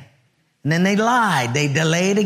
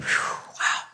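relieved whew; 7 dB quieter than usual. Retold or imitated. Relief after the call.

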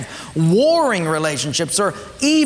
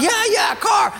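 eager yes; 13 semitones higher than usual. Acted. Acted rich gift recipient.

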